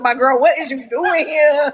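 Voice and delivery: loud, high, drawn-out disbelief with laughter; mock shocked daughter. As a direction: imitate an appalled teen, laughing through it. Acts out daughter's shocked teasing reaction.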